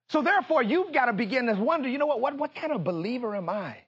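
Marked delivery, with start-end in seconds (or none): none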